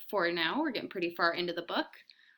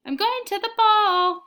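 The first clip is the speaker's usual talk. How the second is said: very high, slow doll voice; a child playing grand lady; high pretend voice; 12 dB louder than usual. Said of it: "in a high pretend voice".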